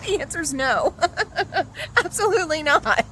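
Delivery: high, loud, through laughter; laughing verdict. Real, not acted. Owner answering for her useless protector pet, laughing. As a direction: crack up while delivering the refusal.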